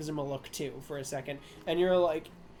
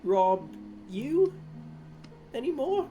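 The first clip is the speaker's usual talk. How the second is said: cheeky question; 3 semitones higher, slower than usual. Acted. Cheeky offer, pitch climbs.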